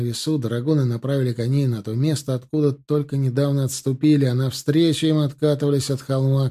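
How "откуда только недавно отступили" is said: plain reading voice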